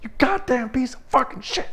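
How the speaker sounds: high-pitched, strained cursing tirade; acted-out screaming rant without much extra volume; re-enacted furious yelling. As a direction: tear into him at the top of your range. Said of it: "continued to yell at him".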